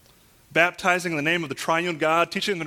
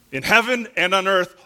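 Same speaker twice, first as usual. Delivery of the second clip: loud, raised preaching voice, hammering the key words; emphatic preaching; 3 semitones higher, 6 dB louder than usual. Real, not acted. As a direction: drive it home to the congregation with force. Preaches at full force.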